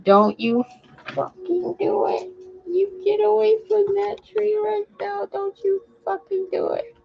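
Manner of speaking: high, loud exasperated scold; angry scolding. Real, not acted. Owner angrily scolding her dog away from the tree. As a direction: bark the order up high.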